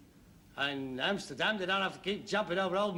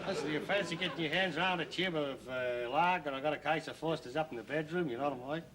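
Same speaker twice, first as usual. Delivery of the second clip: lower, quick Aussie patter; Australian accent; 6 semitones lower, 3 dB quieter, faster than usual. Acted. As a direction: chat someone up in broad Australian. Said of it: "walking around talking like that".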